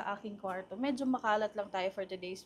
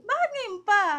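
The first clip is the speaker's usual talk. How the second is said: high, loud whine of protest; exclaimed, high-pitched objection; 13 semitones higher, 9 dB louder than usual. Real, not acted. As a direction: complain loudly in a raised pitch. Protests about early birthday gift.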